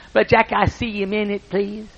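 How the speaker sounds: lightly voiced humble old farmer; Wood's shy request. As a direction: ask politely, not wanting to bother. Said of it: "he talked like this".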